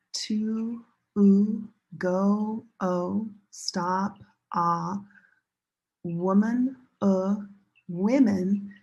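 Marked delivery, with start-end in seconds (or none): none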